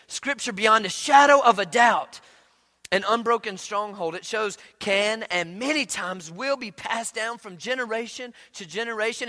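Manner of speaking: loud, rising pulpit preaching; fiery sermon climax; preaching at full force. Acted. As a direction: drive it home to the congregation.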